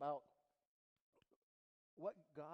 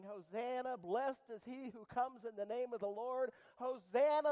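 high, loud shout of praise; loud hosanna; 7 semitones higher, 14 dB louder than usual. Pretend. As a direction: shout it like the crowd at the gate.

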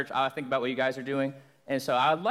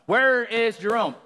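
insistent cop demand; 6 semitones higher, 6 dB louder, slower than usual. Retold or imitated. Cop interrogating loudly.

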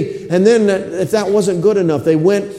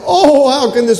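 higher anguished cry; Jeremiah calling out; 7 semitones higher than usual. Retold or imitated. Preacher voicing the weeping prophet, higher mournful cry. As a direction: call out in grief. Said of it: "he's calling out and he's saying".

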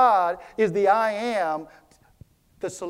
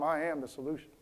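softer, low private mutter; mumbled under breath; 3 semitones lower, 9 dB quieter, faster than usual. Shown as a demonstration. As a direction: say it under your breath to yourself. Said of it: "mumble under your breath".

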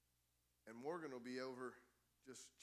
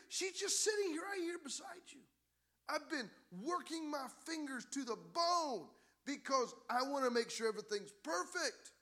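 much higher, louder, slow pleading complaint; frustrated Martha; 13 semitones higher, 10 dB louder than usual. Acted. Martha complaining to Jesus about Mary, high, loud and pleading. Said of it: "she goes and she says".

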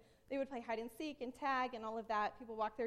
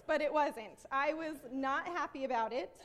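usual speaking voice

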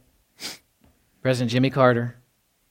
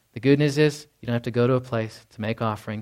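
ordinary talk, nothing marked

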